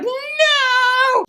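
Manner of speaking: high, long drawn-out cry of no; mock anguished cry. Young man crying out as his friend is taken. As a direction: wail a hammed-up no. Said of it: "this guy's like".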